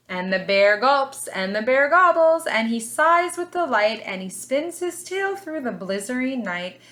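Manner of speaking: put-on lively storybook read for little kids; reading to children. A narrator's reading. Teacher reading a picture book to her class, lively storybook voice.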